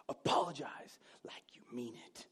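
forceful stage whisper; hissed parental order. Acted. Parent telling son to mean his apology, hissed whisper. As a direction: whisper it as a stern warning.

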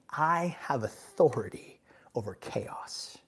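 soft unvoiced whisper, slow; whispered Jesus. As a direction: breathe the words as a calm promise. Jesus speaks calmly in the storm.

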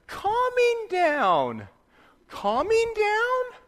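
high, very slow child mocking his father's words; sing-song, drawn out and squeaky, teasing dad; mocking imitation of dad. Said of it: "the little one would make fun of me".

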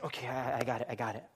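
low, soft reassurance to self; trying to be casual. Retold or imitated. Husband plotting to hide his blunder, quietly collecting himself. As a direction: mutter it as a quiet plan forms. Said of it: "I'm doing this kinda casually".

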